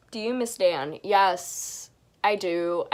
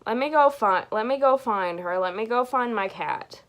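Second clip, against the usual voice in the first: ordinary talk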